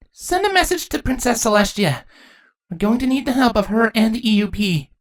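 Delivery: high, urgent princess voice; high-pitched instruction; young princess. Acted. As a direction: send the dragon for help.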